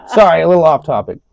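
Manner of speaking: high, laughing apology; apologizing to the co-host for drifting off topic, laughing. Real, not acted.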